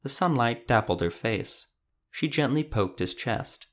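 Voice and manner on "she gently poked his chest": ordinary delivery, nothing marked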